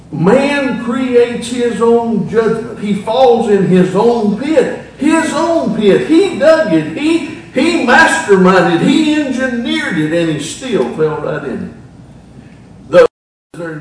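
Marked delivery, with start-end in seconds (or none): 0.1-11.7 loud, high, emphatic preacher; full-force preaching. Real, not acted. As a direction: preach it hard at full volume.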